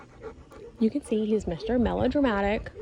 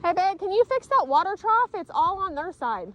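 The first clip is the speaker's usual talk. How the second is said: calling out; 10 semitones higher than usual. Real, not acted. Calling to partner across yard.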